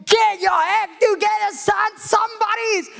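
high, loud mock scolding shout; imagined harsh shepherd scolding the lost son; stern shepherd. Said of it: "the shepherd didn't go".

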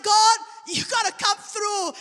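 loud, drawn-out plea to God; urgent plea. Retold or imitated. Preacher retelling his desperate prayer to God, urgent plea.

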